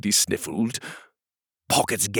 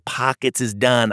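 plain reading voice

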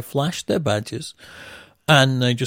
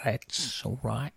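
slow, quiet drawn-out character voice; Ralph; 8 dB quieter than usual. Retold or imitated. Imitating the old tour manager Ralph, slow and quiet. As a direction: impersonate him, drawing the words out softly. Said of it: "he used to talk like that".

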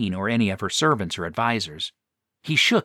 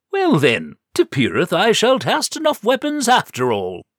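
higher archaic knight voice; pleased knight declaring grandly; bold knight; 8 semitones higher, 5 dB louder than usual. A narrator's reading. Knight pleased with the party, grand and cheerful.

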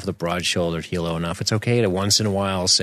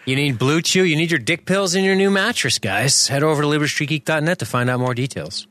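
higher, pushy sponsor pitch; ad read; 7 semitones higher than usual. Pretend. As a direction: sell it like a cheesy radio ad. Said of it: "our new sponsor".

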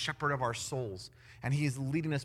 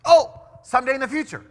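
loud, high "oh" of false understanding; mock realization; 9 semitones higher, 14 dB louder than usual. Preacher plays a listener who misunderstands God's timing, mock realization. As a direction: jump to the wrong conclusion, hammed up. Acts out a mistaken listener's response.

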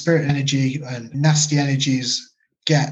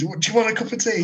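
higher, amused cheery offer; mock British politeness; 8 semitones higher, faster than usual. Shown as a demonstration. Mocking the stepdad's very British offer, higher and amused. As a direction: play up how terribly British it is. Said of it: "Just the most British thing you could do".

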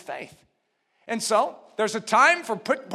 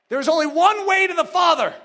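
high, insistent preacher's voice; forceful preaching; 8 semitones higher, 10 dB louder than usual. Real, not acted. Preacher urging listeners to believe for themselves, forceful preaching. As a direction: press each phrase on the congregation.

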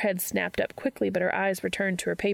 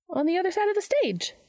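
high, light character voice; Charlie's character voice; 11 semitones higher than usual. A narrator's reading. Charlie answers Dean's question, high-pitched character voice. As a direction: answer in a much higher pitch.

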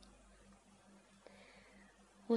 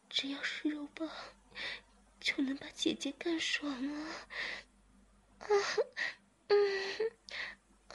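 much higher, breathy moans; moaning character voice; 9 semitones higher than usual. Acted. Zhiming answers master, moaning. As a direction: moan and confess, lost in it.

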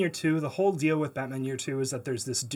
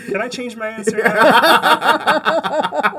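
laughing; 9 semitones higher, 12 dB louder, much slower than usual. Real, not acted. Joking after losing the vote.

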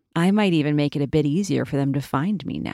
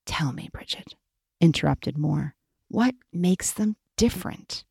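usual speaking voice

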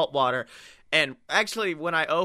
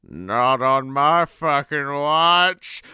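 slow, louder heroic declaration; heroic boom; 7 dB louder, much slower than usual. Retold or imitated. Sea god arrives to save the drowning crew, heroically. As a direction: growl it like an action hero.

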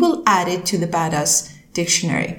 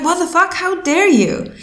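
mock outrage; 10 semitones higher than usual. Indignant retort to annoying M's.

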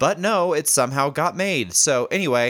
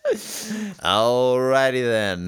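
slow, stretched-out Jim Carrey catchphrase impression; a hint of Jim Carrey drawn out very slowly; much slower than usual. Host answering the co-host's dare with a slow Jim Carrey catchphrase. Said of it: "Matthew McConaughey doing Jim Carrey".

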